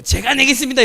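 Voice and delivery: insistent shout. Retold or imitated. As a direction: jump in to grab the bill. Father insisting on paying. (계산하겠다고 우기는 아버지의 큰 소리)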